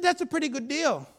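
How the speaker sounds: high-pitched, insistent challenge; acted bold defiance. Father facing a threatening gang member, boldly defiant.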